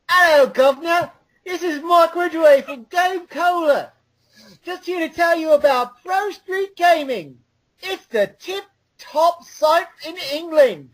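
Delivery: high-pitched hammy Cockney ad read; Cockney chimney sweep accent, pitched high. Pretend. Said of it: "chimney sweep accent you did for me earlier".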